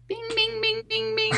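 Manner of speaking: high chiming bings; ding like a quiz show bell; game show bing. Pretend. Game show buzzer imitation.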